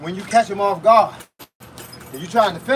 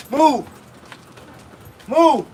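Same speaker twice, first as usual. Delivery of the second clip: higher-pitched one-word order; called-out command; 4 semitones higher than usual. Real, not acted. Coach urging tired boxers to keep moving, calling out. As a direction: call it out between punches.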